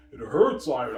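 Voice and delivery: mock whiny cry. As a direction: wail it like she's hurt. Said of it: "I'm like".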